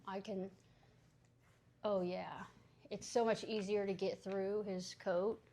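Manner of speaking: ordinary talk, nothing marked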